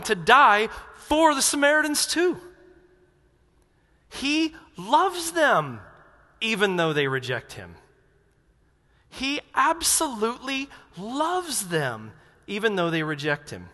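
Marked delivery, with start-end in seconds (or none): 4.1-5.8 loves stretched out, slow and high; slow, much higher stress on loves; emphatic love. Real, not acted. Preacher stressing God's love. 9.1-12.1 absolutely loves them said slow and high; slow, much higher, drawn-out loves; tender emphasis. Real, not acted. Repeats with more emphasis.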